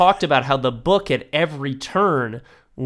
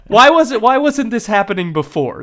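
high, loud amazed asides; mock astonishment; 8 semitones higher, 4 dB louder than usual. Viewer gushing over the villain scene, high astonished voice. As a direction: act stunned the movie is suddenly good. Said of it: "it's like".

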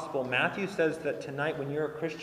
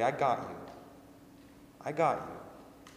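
soothing; 2 semitones lower, 6 dB quieter than usual. Retold or imitated. Dad holds his hurt daughter close, soft and reassuring. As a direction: hush and comfort a scared child. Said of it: "I hold her close and I say".

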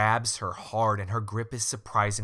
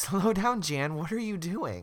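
mock calling out; 8 semitones higher than usual. Acting Sarah calling to Jan.

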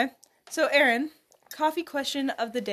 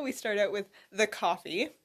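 ordinary talk

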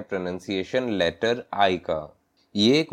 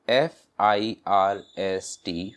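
"एफ़ आई आर एम" spelled slowly, letter by letter; slow spelling; slower than usual. Shown as a demonstration. Teacher spelling for learners, slow letter by letter. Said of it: "first".

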